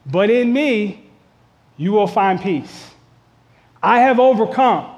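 ordinary talk, nothing marked

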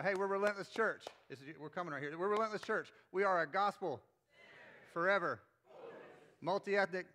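loud, high rallying call; rally the congregation. Shown as a demonstration. Pastor rallies the church with a loud call-out.

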